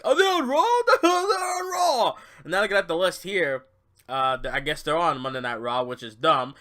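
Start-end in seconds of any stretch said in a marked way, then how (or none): 0.1-2.1 high, loud mock-excited announcer; hammed-up commentator yelp, pitched way up; Michael Cole. Imitating the commentator announcing a Raw move, mock excitement. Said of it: "But then Michael Cole was like".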